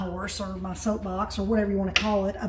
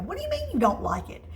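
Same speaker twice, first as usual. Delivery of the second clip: much higher, fast, playful disbelief; mock disbelief; 11 semitones higher, faster than usual. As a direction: act amazed he says no. Grandma scolds grandson.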